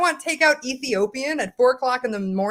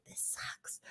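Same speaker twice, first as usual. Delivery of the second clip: whispered mom; 23 dB quieter than usual. Retold or imitated. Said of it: "So she's like".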